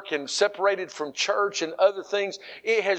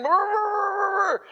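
high, muffled mumbling through grave clothes; mummified Lazarus mumbling; 12 semitones higher than usual. Pretend. Preacher imagining Lazarus bound in grave clothes, muffled high mumble. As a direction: mumble like a man wrapped up tight. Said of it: "I can imagine him going".

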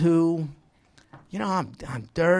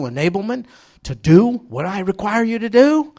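rising, louder challenge; loud rebuke; 4 semitones higher, 9 dB louder than usual. Acted. The Lord rebuking lazy disciples, loud. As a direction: push it out like a rebuke. Preacher voicing Lord's rebuke.

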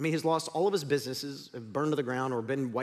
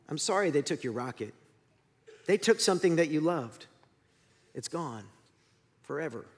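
subdued, halting mournful voice; Sadness. Acted. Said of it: "Sadness walks up and just says".

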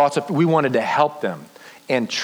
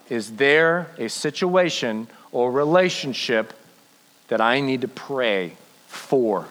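guided prayer. Acted. Leads the congregation in prayer.

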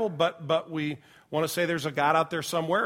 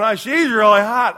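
loud dazed gushing; 5 semitones higher, 12 dB louder than usual. Retold or imitated. Drugged son gushing about nurse.

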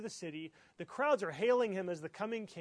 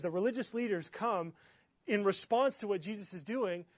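ordinary talk, nothing marked

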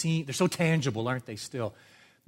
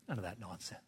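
very soft, low aside; quiet dismissal; 5 semitones lower, 16 dB quieter, faster than usual. Real, not acted. Dismisses the imagined bargaining.

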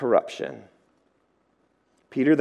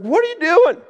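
high, louder squeaky donkey protest; talking donkey; 6 dB louder than usual. Retold or imitated. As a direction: squeal the complaint like an upset donkey. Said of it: "And the donkey's like".

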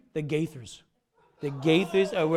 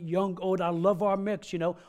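ordinary talk

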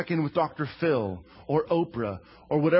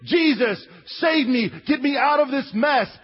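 higher, louder plea; desperate plea; 9 semitones higher, 6 dB louder than usual. Acted. Peter crying louder to Jesus to pull him out. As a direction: beg to be pulled out.

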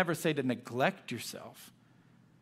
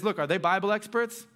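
louder, higher, pressing each boast home; Paul firmly answering the super apostles, raised and insistent; 3 semitones higher, 4 dB louder than usual. Retold or imitated. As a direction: channel Paul standing his ground, firm and fired up. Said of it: "he gets pretty firm here".

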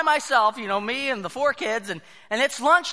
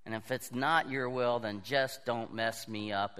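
"not" and "just" said much lower and softer, murmured; hushed prayer; 11 semitones lower, 9 dB quieter than usual. Pretend. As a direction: drop to a low, soft mock prayer. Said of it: "And we say".

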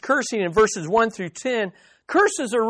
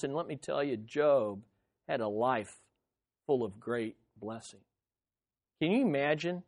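low, soft, near-whispered lament; hushed despair; 10 semitones lower, 10 dB quieter than usual. Acted. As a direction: drop to a hush as if confessing. Quiet aside voicing Job's wish.

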